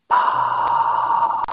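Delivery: long voiceless whispered ah; open-mouthed sigh of whispered breath, held long. Shown as a demonstration. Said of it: "So whisper".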